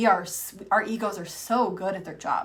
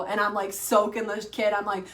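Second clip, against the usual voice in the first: ordinary talk, nothing marked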